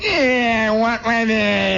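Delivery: high, drawn-out bratty whine; mock little-kid bleat; whining kid. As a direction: whine back like an unimpressed little boy. Melvin's bratty reply.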